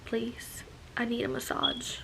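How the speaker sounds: soft little self-quoted plea; mock pleading. Pregnant woman begging her boyfriend for a massage, softly pleading. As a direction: beg quietly, as if retelling it. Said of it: "And I'm like".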